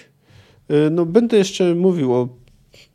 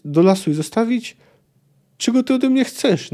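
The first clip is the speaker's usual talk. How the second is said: pleading mother; 7 semitones higher than usual. Acted. Mother pleads in parents' quarrel.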